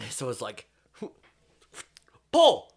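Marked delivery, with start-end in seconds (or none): 2.3-2.7 much higher and louder yell; mock shout. Co-host acting out the grenade throw, shouting. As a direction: bark it like a command. Mimicking grenade pin cry.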